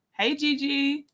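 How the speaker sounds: ordinary delivery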